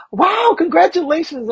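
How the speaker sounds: high, bright impressed lady. Retold or imitated. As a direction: gush at the news like the lady. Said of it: "And she goes".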